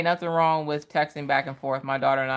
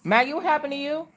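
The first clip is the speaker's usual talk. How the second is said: much higher call across the house; 12 semitones higher than usual. Real, not acted. Mother calling to her crying daughter, pitched way up.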